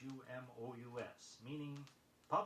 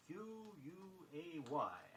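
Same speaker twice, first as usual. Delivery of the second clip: ordinary talk, nothing marked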